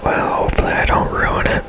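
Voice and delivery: ordinary talk, nothing marked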